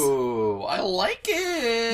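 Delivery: long drawn-out swooping ooh; slow, sing-song approval; playful delight. Real, not acted. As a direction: stretch it out high with relish.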